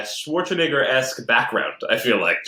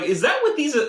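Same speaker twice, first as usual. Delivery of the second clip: high, amazed question; mock shock at the game; incredulous. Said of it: "But I was like".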